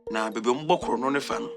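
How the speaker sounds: normal talking voice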